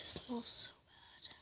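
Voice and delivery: disgusted whisper about the smell; hushed, grossed-out whisper. Real, not acted. Repeats smell complaint.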